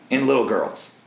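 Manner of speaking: ordinary talk, nothing marked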